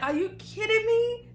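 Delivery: high, loud disbelieving cry; retold disbelief. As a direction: squeal it out in outrage over broken shades. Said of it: "I'm like".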